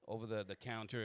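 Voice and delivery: ordinary talk, nothing marked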